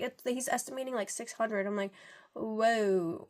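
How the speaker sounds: normal talking voice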